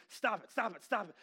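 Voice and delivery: rapid-fire loud interruption; Bob Newhart. Retold or imitated. Counselor cutting client off over and over, loud repeated command. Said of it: "a two-minute thing of just".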